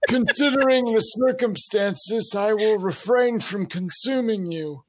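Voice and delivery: high-pitched, put-on Santa. Santa looking down at the gingerbread men, put-on high voice. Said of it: "as Santa, I will kind of look down at them and say".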